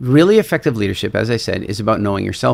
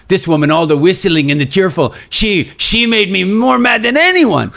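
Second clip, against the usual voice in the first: retold fury, high and loud, slowing at the end; really angry; 8 semitones higher, 5 dB louder than usual. French climber rages about the cheerful whistling woman, angrily. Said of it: "really angry".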